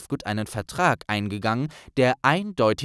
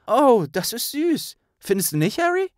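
touched; 12 semitones higher, 4 dB louder than usual. Acted. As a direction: melt at something sweet. Hermine gerührt. (gerührt, hoch und langsam)

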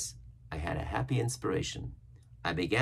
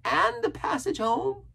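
very high, slow castaway voice; Ben Gunn, shrewd; 3 dB louder, slower than usual. A narrator's reading. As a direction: ask slyly in a high, drawn-out character voice. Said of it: "he added with a look of great shrewdness".

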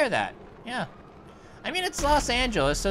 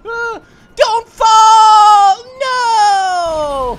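screaming high and loud in alarm; panicked shout; 11 semitones higher, 15 dB louder, much slower than usual. Real, not acted. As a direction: yell out as he tips over. Character about to fall.

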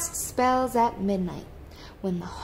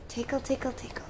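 soft playful; 4 semitones higher, 7 dB quieter, faster than usual. Acted. Playful tickling sound effect.

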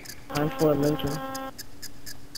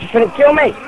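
alarmed protest; 7 semitones higher, 4 dB louder than usual. Real, not acted. Player protests to teammate in game.

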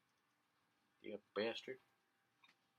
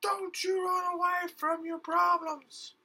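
mock scolding host; 6 dB louder, slower than usual. Host scolds fleeing player.

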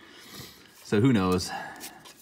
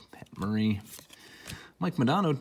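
ordinary talk

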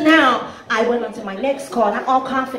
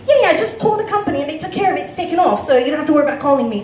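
put-on British accent; 3 semitones higher, faster than usual. British debtor fobbing off the collector, British accent. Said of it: "he's got a British accent".

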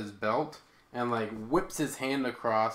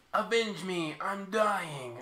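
high, hammy dying plea; overacted death scene; 8 semitones higher, slower than usual. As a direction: ham up a dying man's last words. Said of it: "And the brother's like".

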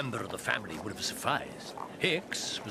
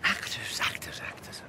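whispered to himself, pondering; musing whisper; 2 semitones lower than usual. Acted. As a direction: turn the word over in a whisper. Detective muses under his breath.

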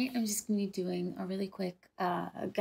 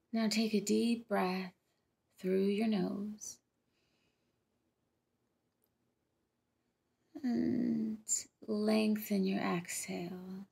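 guided meditation; slower than usual. Acted. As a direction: gently guide them into relaxing, letting each phrase settle.

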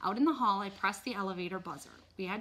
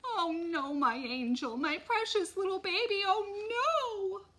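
high, wailing lament, rising to a long cry; hammed-up tearful moan; moaning mother; 12 semitones higher, 4 dB louder, slower than usual.

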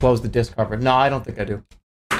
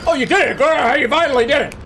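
excited cheering; 7 semitones higher, 3 dB louder than usual. Real, not acted. Cheering on a game unit.

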